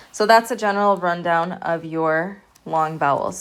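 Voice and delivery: usual speaking voice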